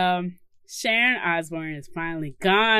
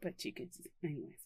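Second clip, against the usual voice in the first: trailing off; 6 semitones lower, 18 dB quieter, much faster than usual. Real, not acted. Dropped nearly unvoiced.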